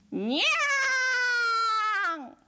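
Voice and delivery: long high drawn-out meow; cat meow. Acted. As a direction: yowl like a cat. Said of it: "隣のじいさんの鳴き真似".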